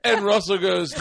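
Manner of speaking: ordinary talk, nothing marked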